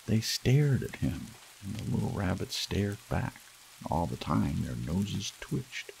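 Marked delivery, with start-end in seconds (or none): none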